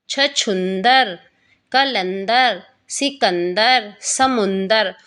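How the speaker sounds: पचरंगा, शिवगंगा, पगडंडी said very slowly with the nasal held; slow word-by-word reading for children; longer words drill. Shown as a demonstration. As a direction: stretch out each longer word so a child can follow. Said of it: "इसके बाद कुछ बड़े शब्द".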